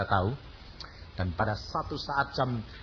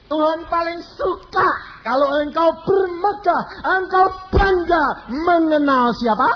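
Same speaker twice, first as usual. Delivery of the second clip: loud, high, slow sermon call; fervent preaching; 8 dB louder than usual. Real, not acted. Preacher challenging the congregation, loud and high. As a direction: drive the question home to the crowd.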